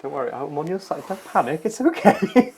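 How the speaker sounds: high-pitched placating voice; mock soothing. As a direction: soothe them like a fretful child, playfully. Mock-soothing a worked-up co-host.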